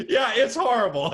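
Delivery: laughing through the words; can't stop laughing. Real, not acted. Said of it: "I shouldn't be laughing".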